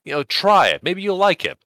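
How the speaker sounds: coaxing. Retold or imitated. Club coaxing the girlfriend.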